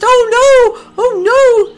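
high, loud cry of alarm; panicked cry. Acted. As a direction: cry out as if the babies are lost. Goose panics over lost goslings.

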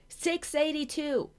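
high, loud, slow shouted number; shouted score. Acted. Marina shouting her score back, high loud call. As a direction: yell it back across the air.

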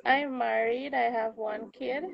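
normal talking voice

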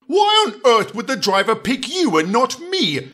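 indignant Gordon. Acted. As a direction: whine it like a jealous big engine. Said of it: "he exclaimed".

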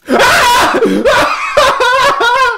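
very high, loud drawn-out wail; shrieking wail; wailing scream. Real, not acted. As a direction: howl in fright and despair. Upset and frightened after dying.

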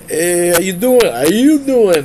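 loud, high New York greeting; New York accent. Acted. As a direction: ham up a New Yorker's call. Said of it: "like, New Yorker".

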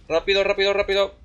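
high, loud hurried urging; urgent hurry. Real, not acted. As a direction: rush it, frantic.

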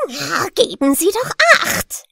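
put-on loud, high, cross snap from an old willow stump; an annoyed old tree telling someone off; angry voice. A narrator's reading. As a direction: scold the passer-by sharply. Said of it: "sagte eine ärgerliche Stimme".

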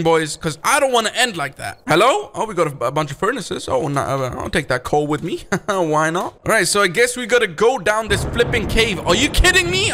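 normal talking voice

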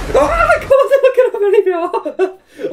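high squealing laugh through the words; giggling in disbelief at the screen. Real, not acted. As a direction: laugh helplessly while talking.

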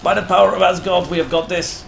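rally the troops at full voice; By the power of Asgard shouted high and fast as a rallying cry; loud battle cry. Acted. Rallying raiders mid-fight.

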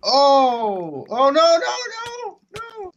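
high panicked no, no, no; panicked screaming. Real, not acted. Friend dying to monsters.

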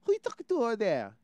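high, quick, nosy mom; mock mom voice, fast and high; voiced local mom. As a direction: imitate a mother calling out a quick question. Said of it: "his mom's like".

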